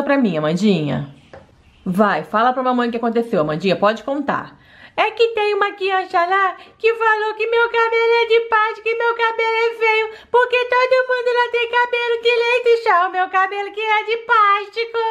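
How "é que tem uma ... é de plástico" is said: put-on higher, childlike doll girl voice; little girl voice. Daughter complaining to mom about school, higher childlike voice. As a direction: pout like a hurt little girl.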